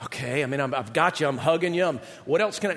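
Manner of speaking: understated low fumbling for words; stumped Jesus. Acted. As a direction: think aloud, low and at a loss. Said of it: "and he's like".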